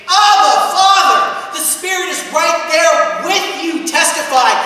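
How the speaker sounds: loud, high, ringing pulpit voice; full-force preaching. Acted. Pastor urging Central toward the Father, full-force preaching. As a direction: drive it home to the congregation. Preaching climax, Spirit testifying.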